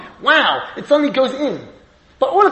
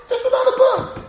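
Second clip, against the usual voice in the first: "not" pitched far higher, with a laugh; retelling the rabbi's emphatic line; emphatic denial. Retold or imitated. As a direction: insist it is more than a book. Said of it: "So Moshe used to say".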